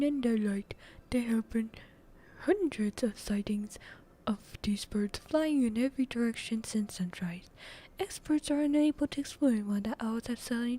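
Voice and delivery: loud, emphatic news-anchor delivery; punchy bulletin read; TV newscaster. Acted. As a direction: project it like an evening news report. Reads as the newscaster.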